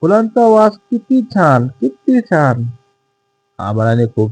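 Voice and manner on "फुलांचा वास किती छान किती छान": high-pitched, gleeful rooster call; lightly voiced rooster, pitched up. A narrator's reading. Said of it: "दूर जाऊन पुन्हा ओरडला".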